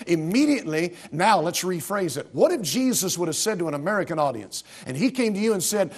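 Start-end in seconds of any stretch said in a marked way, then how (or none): none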